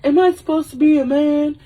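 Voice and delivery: high, loud mock anguish; hammed-up dramatic outcry; melodramatic speech. [name]'s big overacted speech.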